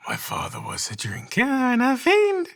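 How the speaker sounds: high falsetto sweep; siren technique. Shown as a demonstration. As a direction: swing up into falsetto and play. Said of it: "siren technique ... low voice ... high voice".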